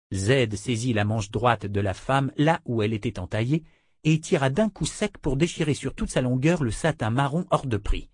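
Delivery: plain reading voice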